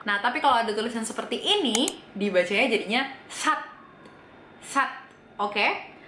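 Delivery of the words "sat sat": "sat" closed on an unreleased T; final S as T. Shown as a demonstration. Teacher reading a final Korean letter, stopped T. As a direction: stop "sat" short with the tongue, no hiss at the end. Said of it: "S yang udah jadi T".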